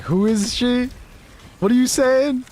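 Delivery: high, put-on voice of a baffled Aussie; hammed-up indignant quote; mock offended Aussie. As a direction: play all of Australia taking offence, lightly. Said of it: "All of Australia is now like".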